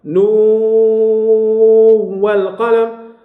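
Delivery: نون held long, the final n sounded clearly before والقلم; drawn-out recitation demo. Shown as a demonstration. Teacher to class, recites نون والقلم slowly with the n kept clear. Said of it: "لكن العكس في نون والقلم".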